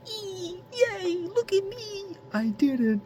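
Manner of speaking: very high, slow giddy squeal. Retold or imitated. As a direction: squeal like a thrilled kid. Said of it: "I'm giddy".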